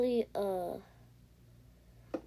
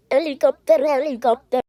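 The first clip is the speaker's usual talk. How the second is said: excited callout; 5 semitones higher, 11 dB louder than usual. Real, not acted. Excited calling out.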